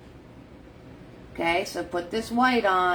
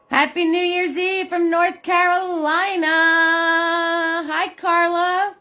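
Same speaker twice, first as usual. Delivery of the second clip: higher and louder, beaming hello; cheery greeting; 9 semitones higher, 6 dB louder than usual. Real, not acted. Painter greeting viewers live on New Year's Eve, cheery call. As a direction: call out a bright welcome.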